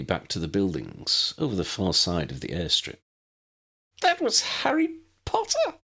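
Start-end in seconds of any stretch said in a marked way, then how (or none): 4.0-5.8 very high, thrilled squeal; excited squeal. A narrator's reading. Tally squealing to her brother about meeting Harry Potter. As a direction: squeal it like a starstruck fan. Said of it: "Tally squealed".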